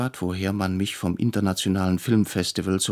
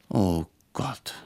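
whispered, breathless oh god; a barely voiced, despairing whisper; inner groan. A narrator's reading. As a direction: groan it under your breath in dismay. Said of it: "stöhnte ich innerlich".

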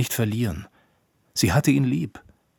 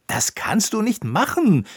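high, outraged shout; Koníček; 11 semitones higher, 5 dB louder than usual. A narrator's reading. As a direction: cry out in loud protest. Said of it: "rief Koníček". (empört rufend)

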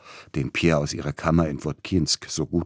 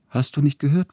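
much higher, louder governess voice; calling to a child; 11 semitones higher, 5 dB louder than usual. A narrator's reading. Fanny to little Pierre at his drawing, high, insistent call. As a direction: raise the voice to get a child's attention.

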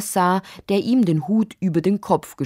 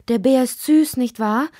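vorsichtig fragendes Kind, hoch; zögerlich; 8 semitones higher, 4 dB louder than usual. A narrator's reading. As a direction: taste dich mit heller Kinderstimme vor. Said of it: "sagte Lotti zögerlich". (zaghaft, mit hoher Stimme)

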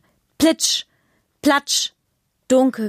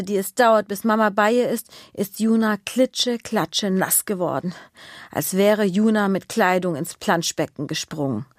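ordinary delivery, nothing marked

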